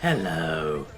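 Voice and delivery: low, slow villain croon; Imperfect Cell voice. Retold or imitated. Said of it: "I was singing [name] as Imperfect Cell".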